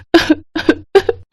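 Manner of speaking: high, soft wicked chuckle; a sly villain's giggle; gloating laugh. Acted. Sister-in-law gloating over bald Sanvi, soft wicked laugh.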